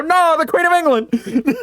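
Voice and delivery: high, slow, laughing cry of alarm; mock horrified cry. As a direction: wail it in fake horror, laughing. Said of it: "I just go".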